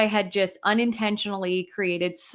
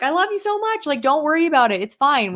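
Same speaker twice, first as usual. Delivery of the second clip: aunt comforting; 6 semitones higher, 3 dB louder than usual. Retold or imitated. Aunt reassuring her after the eulogy.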